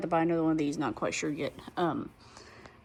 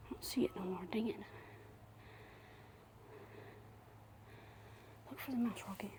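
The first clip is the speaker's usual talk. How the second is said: hushed whisper, stalking a mouse; 4 semitones higher, 21 dB quieter than usual. Real, not acted. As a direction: whisper so the mouse won't hear. Sneaking up on a mouse.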